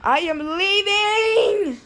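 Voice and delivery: high, slow announcement; hammed-up slow exit. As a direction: drag it out as you leave. Storming off dramatically.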